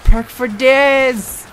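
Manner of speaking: slow, loud mock cheer; excited. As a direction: stretch it out in delight. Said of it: "It'd be like".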